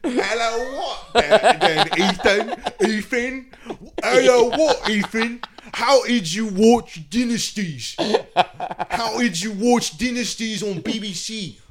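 high, hammed-up Cockney interrogation; mock Cockney prosecutor. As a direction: grill him in a silly, exaggerated Cockney accent. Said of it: "Like this, this Cockney prosecutor".

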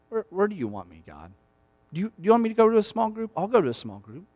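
hushed, sincere asking of God; soft honest prayer. Pretend. Congregant quietly praying to God, soft and sincere. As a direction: pray it low and humble. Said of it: "honestly pray and say".

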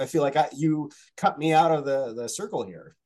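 normal talking voice

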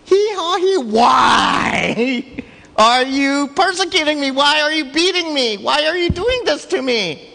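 high, loud braying plea; talking donkey. Retold or imitated. The donkey pleading with Balaam, high loud bray. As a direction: whine it like a wronged donkey. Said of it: "And then all of a sudden was like".

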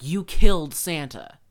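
loud mock accusation from the kid; accusing kid. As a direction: shout it like a kid pointing a finger. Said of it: "immediately was like".

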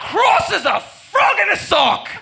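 put-on Australian accent. Aussie leader yelling insults to the mob. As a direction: bellow the slang through laughter. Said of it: "Aussie slang rant".

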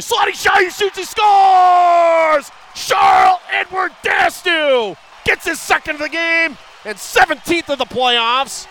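very high, loud, stretched goal shout; goal call shout. Real, not acted. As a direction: scream the goal home. Goal call in hockey broadcast.

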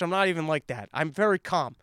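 usual speaking voice

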